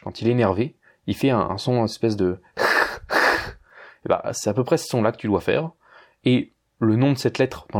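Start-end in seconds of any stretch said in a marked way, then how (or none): 2.6-3.5 harsh breathy hiss like a spitting cat; hissing cat sound. Shown as a demonstration. Teacher imitating a cat spitting to show Russian H. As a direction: rasp from the throat like an angry cat. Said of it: "semblable au chat lorsqu'il crache".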